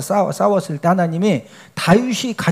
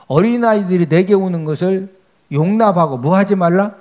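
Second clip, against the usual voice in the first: normal talking voice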